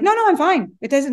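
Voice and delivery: high, hurried fake denial; mock too-quick reassurance; false reassurance. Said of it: "you can't lie".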